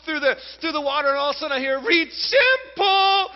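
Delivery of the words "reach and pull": put-on high, loud, desperate little-girl chant; breathless toddler. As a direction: imitate a small child shouting swim-lesson words while struggling. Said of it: "she's totally out of breath".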